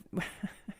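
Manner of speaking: soft, breathy laugh under the breath; breathy chuckle. Real, not acted. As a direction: let out a quiet, voiceless chuckle. Laughs before bringing up court rulings.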